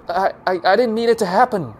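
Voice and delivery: panicked stammer, high and loud; stammering blurt. A narrator's reading. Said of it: "he blurts out".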